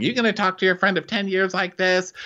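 high-pitched scolding yell, not full volume; mock screaming. Host mimics Jen challenging her friend, mild mock yell. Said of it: "so she's still screaming".

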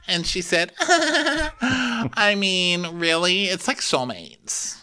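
very high put-on voice with mock laughs; Victoria. Telling them about Amel, high hammed-up gushing. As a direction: gush high, breaking into fake giggles. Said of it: "So Victoria's like".

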